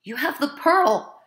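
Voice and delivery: high, loud, slow desperate cry; hoarse cry. Acted. Wife crying out to her husband about the pearl, desperate loud cry. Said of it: "she cried hoarsely".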